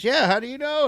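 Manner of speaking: loud, high-pitched quote of the owner; surprised reply. Retold or imitated. Repeats shop owner's surprised reply.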